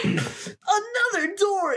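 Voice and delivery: very high, slow hammy shout; bad acting. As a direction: overact the discovery, drawn out. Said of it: "getting into character".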